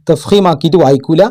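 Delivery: normal talking voice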